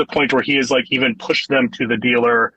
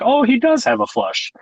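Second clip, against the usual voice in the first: surprised dealer; 12 semitones higher than usual. Retold or imitated. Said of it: "He's like".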